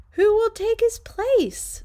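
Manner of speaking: put-on high, loud anxious kids' cry; anxious kids. Kids hearing the coach is gone, high loud worry. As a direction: cry it out like worried children. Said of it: "said the kids".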